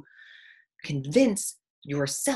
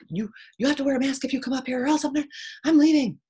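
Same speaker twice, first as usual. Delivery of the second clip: mock demand; 9 semitones higher, 6 dB louder, faster than usual.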